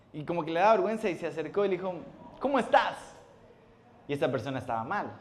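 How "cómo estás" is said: high, loud cheery "¿Cómo estás?"; bright greeting. Retold or imitated. Shy man greeting an acquaintance, high cheery hello. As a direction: greet him with nervous cheer.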